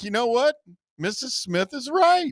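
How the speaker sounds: high, slow quoted voice; high mock voice. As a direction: quote yourself in a high sing-song voice. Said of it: "I was like".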